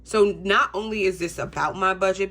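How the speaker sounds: ordinary talk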